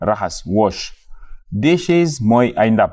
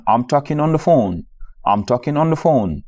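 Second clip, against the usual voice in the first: ordinary talk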